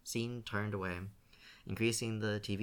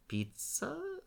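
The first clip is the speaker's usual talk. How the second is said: high, hesitant rising Pizza; sheepish question. A narrator's reading. Shane sheepishly answers his grandmother. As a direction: let Pizza lift up like a guilty question. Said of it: "sounded almost like a question".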